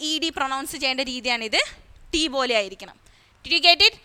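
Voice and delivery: normal talking voice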